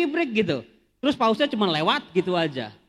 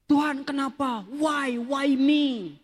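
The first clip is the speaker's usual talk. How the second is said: anguished plea; 7 semitones higher, slower than usual. Retold or imitated. Retold anguished prayer when sick.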